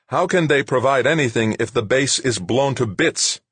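high, loud angry shouting. A narrator's reading. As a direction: shout it at him in rage. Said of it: "He fairly shouted the question".

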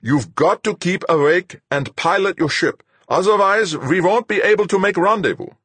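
loud, high, urgent insistence; snapping. A narrator's reading. Fuchs orders the fading pilot to keep awake, snapping. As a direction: drive the pilot on forcefully. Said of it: "he snapped".

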